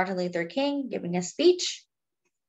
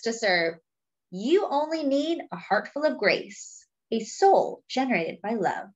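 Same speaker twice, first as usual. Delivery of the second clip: rhythmic, emphatic reading of a famous quote; stirring recitation for a class; reading a quote aloud; 4 semitones higher than usual. Acted. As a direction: read it like a sermon line to children. Reads Martin Luther King quote aloud.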